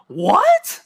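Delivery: shocked disbelief. Retold or imitated. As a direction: squeal in outrage. Mock outrage at hospital advice.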